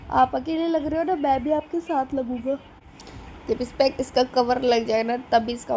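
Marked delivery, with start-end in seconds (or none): 0.1-2.6 retold child. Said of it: "Vanshu बोल रहे थे".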